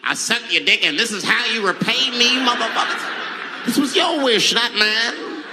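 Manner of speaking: lightly voiced wounded host; a hint of indignant [name]. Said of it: "Mike must be confused, like".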